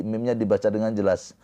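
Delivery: ordinary delivery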